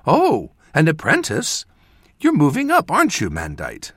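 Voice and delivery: high, delighted mother voice; proud proclamation. A narrator's reading. Mother proudly teasing her son about his apprentice, delighted. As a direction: announce it with beaming pride. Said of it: "she proclaimed".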